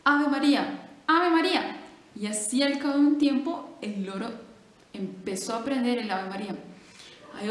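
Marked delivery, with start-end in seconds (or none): 0.0-1.8 loud, emphatic repetition; teaching friar. Acted. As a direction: drill it loudly into the bird. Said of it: "le decía".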